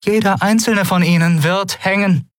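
hohe, kühle Drohstimme; kühle Drohung. A narrator's reading. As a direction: deutlich höher und unerbittlich kühl drohen. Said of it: "wie kühl ihre Stimme blieb". (kühl drohend, in hoher Lage)

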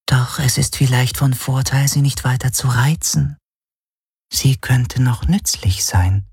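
high whispery voice, slowing into a full whisper; whispering shadow creature. A narrator's reading. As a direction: counsel slyly as a whispering shadow. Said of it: "hörte er die Schattenhelix flüstern". (hohe, flüsternde Schattenstimme)

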